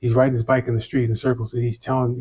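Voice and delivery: ordinary talk, nothing marked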